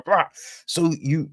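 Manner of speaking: ordinary talk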